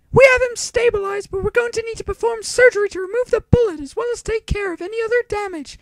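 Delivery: high formal doctor's question; female doctor voice. A narrator's reading.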